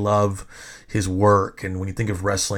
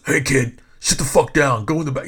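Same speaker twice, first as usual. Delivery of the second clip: retold bully's rebuke, higher pitch; bullying command; 4 semitones higher, 3 dB louder than usual. Photographer ordering the kid to sit down, imitated bullying. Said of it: "trying to fucking bully me".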